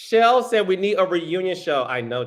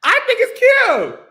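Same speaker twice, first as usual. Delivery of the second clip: very high, loud gush; high-pitched excited outburst; gushing squeal; 8 dB louder than usual. Real, not acted. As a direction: squeal it out in delight, holding the last word. Playfully defending the imitation she did.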